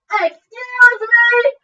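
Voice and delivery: slow, high mock shout of excuse me; yelling across the room. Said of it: "Her shouting across the room".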